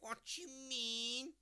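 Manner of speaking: high, long drawn-out whine; whiner. Acted. Demonstrating the Scouse whiner type, high drawn-out whine. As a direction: stretch it up high into a slow whinge. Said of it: "is the whiner".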